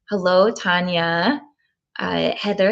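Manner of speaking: normal talking voice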